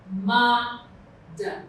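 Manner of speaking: MA-DAM split, long weighty MA, then a detached dam; ma-dam broken in two to show the stressed MA; stress on first syllable. Shown as a demonstration. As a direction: lean on the ma of ma-dam, pause before dam. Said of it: "That's the stress".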